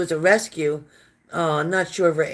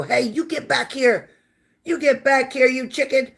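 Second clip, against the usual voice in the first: calling after the dog; 7 semitones higher than usual. Retold or imitated. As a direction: stretch it out, calling a runaway dog back. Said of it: "she goes around the corner and go".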